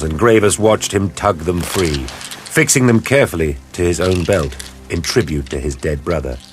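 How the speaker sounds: usual speaking voice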